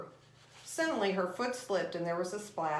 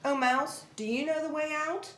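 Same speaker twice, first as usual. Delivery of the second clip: lightly voiced, higher, polite formal address; formal address to a mouse; 7 semitones higher than usual. Alice politely addressing the mouse, higher and formal. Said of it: "the proper way to talk to a mouse".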